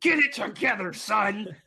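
laughing, high stern father; mock stern dad. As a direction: play a scolding dad with a laugh. Stern dad reply to kid.

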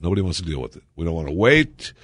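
ordinary talk, nothing marked

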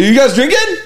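lightly mocked eager guy; mimicking his friend again. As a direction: ham up the eager tone. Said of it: "And that's how he talks".

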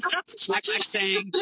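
slow, high mock scold; teasing scolding; playful scold. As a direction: drag it out teasingly. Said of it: "I'm like".

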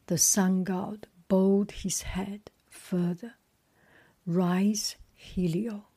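slow, measured storybook reading; story narration. A narrator's reading. Reader telling the myth of Phaeton, measured story narration. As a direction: read it out phrase by phrase, with pauses.

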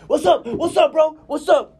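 high and loud yappy tough-guy voice; little dog voice. Retold or imitated. Voicing the little dog squaring up to the big dog, high and loud. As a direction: play the tiny dog picking a fight.

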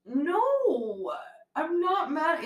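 high, drawn-out mock protest; amused denial. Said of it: "laughing because I'm like".

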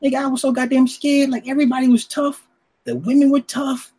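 high scared voice. Retold or imitated. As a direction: play it up, frightened. Retelling being scared in club.

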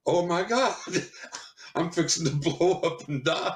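high, laughing mock panic; giggling imitation of sheer terror. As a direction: laugh through the retold fright. Panicked quote, laughing while retelling.

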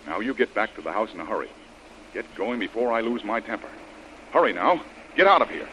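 loud, rising angry orders; angry scolding. Acted. Hero ordering the girl back to the house, angry. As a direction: lose your temper with her.

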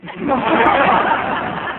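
airy, breathless laugh; burst of laughter. Real, not acted. Straight man cracking up at his partner's joke, laughing.

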